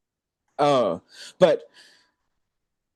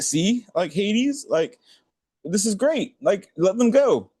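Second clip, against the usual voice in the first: much higher, coaxing plea; pleading Persephone; 10 semitones higher, slower than usual. Retold or imitated. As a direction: sweetly urge him to let them go.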